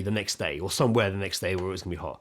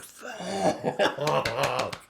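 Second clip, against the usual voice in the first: retold exasperation; 5 semitones higher than usual. Retold release after the confrontation.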